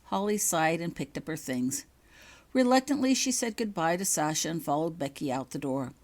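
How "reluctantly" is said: usual speaking voice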